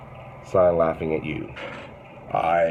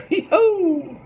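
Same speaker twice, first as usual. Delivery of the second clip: high squealing hee-hoo cackle; a falsetto whoop of a laugh; cartoonish old-man giggle, way up high; giddy hee-hoo laugh; 5 dB louder than usual.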